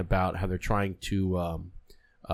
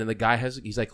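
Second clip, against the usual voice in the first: ordinary talk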